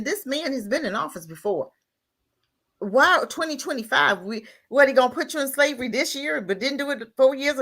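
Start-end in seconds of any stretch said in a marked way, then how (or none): none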